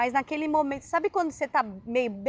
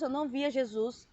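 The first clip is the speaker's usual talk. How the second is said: usual speaking voice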